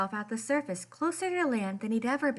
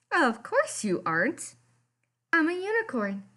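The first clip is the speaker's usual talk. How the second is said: knowing unicorn voice; 5 semitones higher, 3 dB louder than usual. Acted. Friend answering Kelp's news, lightly voiced and matter-of-fact. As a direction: say it as if it's obvious.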